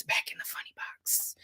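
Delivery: whispered to herself. Real, not acted. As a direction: whisper it to settle down. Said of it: "Okay, back in the funny box".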